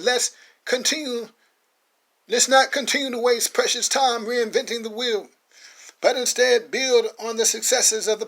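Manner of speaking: high, loud sermon voice building to a peak; a bishop rousing his flock from the pulpit; preaching. Acted. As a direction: preach to the congregation at full force. Sermon to brothers and sisters.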